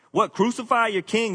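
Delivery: mocking. Pilate mocking the crowd, high mocking question. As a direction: sneer it back at them, high. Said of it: "He's mocking them".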